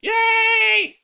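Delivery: cheering. Real, not acted. Cheering a viewer.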